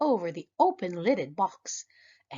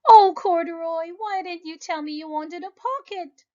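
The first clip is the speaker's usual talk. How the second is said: put-on high, fond girlish voice; girl hugging her bear, fond; girl's voice; 10 semitones higher, 8 dB louder than usual. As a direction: tenderly chide the bear. Lisa fondly scolding bear.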